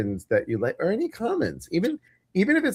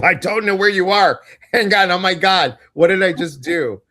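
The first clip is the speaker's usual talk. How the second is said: higher, louder burst of real fluster; flustered alarm; 7 semitones higher, 7 dB louder than usual. Real, not acted. Host loses sight of co-host after pressing a button, flustered. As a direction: blurt it out, suddenly thrown.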